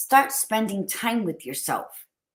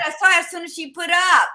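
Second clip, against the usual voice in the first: excited interjection; 9 semitones higher, 6 dB louder, faster than usual. Real, not acted. Cuts in to correct cohost.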